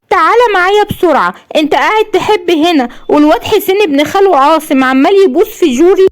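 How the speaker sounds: put-on high-pitched, irritated eight-year-old; agitated little boy. A narrator's reading. Son urging his father to come quickly, high agitated child voice. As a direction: pitch it way up, an indignant child tattling. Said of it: "قال رعد اللي عنده تمن سنين بعصبيه".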